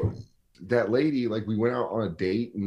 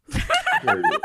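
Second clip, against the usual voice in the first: much higher, hammed-up outraged scold; mock stern scolding; 4 dB louder, slower than usual. Man doing a mock light punishment during sex, hammed-up high scold. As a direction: play a scandalized aristocrat, overdone. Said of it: "a light punishment".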